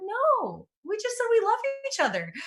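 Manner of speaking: high fast annoyed retort; high exasperated reply. Retold or imitated. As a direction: squeak it out quickly, fed up. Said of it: "I'm like".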